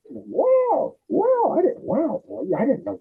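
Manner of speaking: high amazed wows; mock amazement; cousin amazed. Cousin seeing his music files, high amazed voice.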